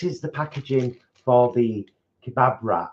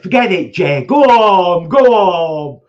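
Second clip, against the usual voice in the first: high, loud hammed-up yell; exaggerated shout; 10 semitones higher, 13 dB louder than usual. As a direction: overplay the retold outburst. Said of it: "He didn't say it like that".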